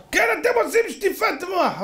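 high-pitched mock outburst; indignant accusation. Father accusing the rabbis of brainwashing, high and heated. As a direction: fire back in a high, heated voice.

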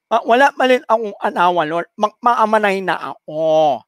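a woman with a cleft-palate nasal speech, pleading to the Lord; nasal-speech woman praying. Acted. Desperate spinster begging the statue for a husband, nasal pleading. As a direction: pray through a blocked, nasal voice, consonants swallowed. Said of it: "Ngongo nga siya, di ba?".

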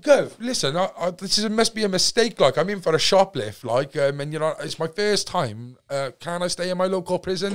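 worked-up protest to an officer; retold pleading. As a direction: re-enact begging the guv to see reason. Pleads with officer against transfer.

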